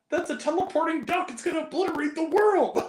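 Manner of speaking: very high-pitched hammed-up doom warning; mock frantic. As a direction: squeal the absurd warning, near laughter. Retold frantic quote, cracking up.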